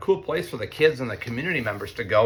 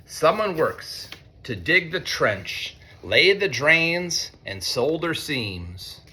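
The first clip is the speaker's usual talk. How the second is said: slow, higher refrain, dropping on the dream; reading to children; 5 semitones higher, much slower than usual. A narrator's reading. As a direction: lean into the refrain slowly. Reads picture book to students.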